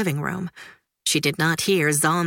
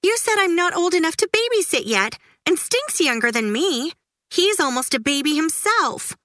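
put-on high, quick, complaining girl; high indignant child voice; Judy's indignant voice; 10 semitones higher than usual. A narrator's reading. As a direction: rattle off the protest like a jealous kid sister.

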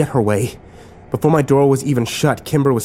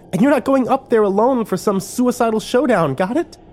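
high-pitched, insistent order; demanding; 12 semitones higher than usual. A narrator's reading. Kimber forbids a lone suicidal showdown, demanding. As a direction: lay down the law, pitch well up. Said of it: "she demanded".

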